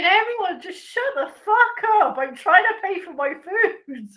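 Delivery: a hammed-up shout, high and loud; mock shout. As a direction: yell at the whole shop in jest. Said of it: "it's more like saying".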